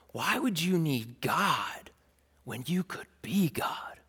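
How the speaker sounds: slow, hushed tempter; soft, insinuating question; seductive whisper. Acted. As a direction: plant the idea slowly, trailing into a whisper. Voicing the serpent's temptation.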